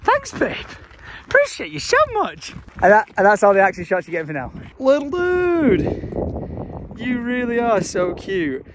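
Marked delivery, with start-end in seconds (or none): 0.0-2.5 playful high thanks. Real, not acted. Teasing thanks to girlfriend. 4.8-8.6 cooing at animal. Real, not acted. Talking to a chipmunk.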